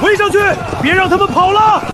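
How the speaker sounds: high, loud battle order; shouted command. Acted. Officer sending soldiers to surround the enemy, loud urgent shout. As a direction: yell orders to the troops.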